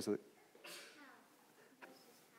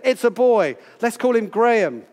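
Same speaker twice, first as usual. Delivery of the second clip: joyful announcement; 5 semitones higher, 5 dB louder than usual. Acted. Parents naming a newborn boy.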